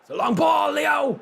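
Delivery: high, loud yell at the screen; shouting. Real, not acted. Gamer yelling at his striker on screen, loud shout. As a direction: call the pass out with a yell.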